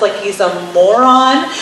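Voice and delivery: ordinary talk, nothing marked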